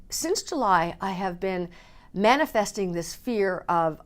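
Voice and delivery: ordinary talk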